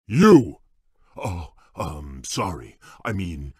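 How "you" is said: louder, higher bark of a call. Acted. Beast calling to a guest, loud. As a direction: shout to catch attention.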